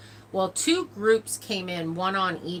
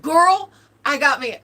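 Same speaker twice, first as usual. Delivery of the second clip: excited reassurance; 9 semitones higher, 9 dB louder than usual. Retold or imitated. Cousin reassuring on phone.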